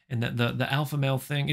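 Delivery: usual speaking voice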